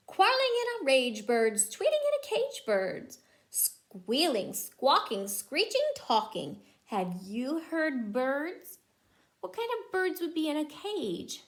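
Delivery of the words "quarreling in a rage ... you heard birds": lively rhyming picture-book read; reading to children. Acted. Mother reading a bird book to her child, lively rhyme. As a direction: bounce the rhyme for a small child.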